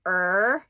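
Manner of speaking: "Er" with the tongue curled back, said loud; retroflex er. Shown as a demonstration. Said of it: "you make a sound".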